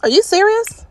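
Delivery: high, loud disbelieving cry of are you serious; can't believe what the sim just did; incredulous. Real, not acted. Gamer to her disobedient sims, high incredulous outburst. Sims keep picking babies up.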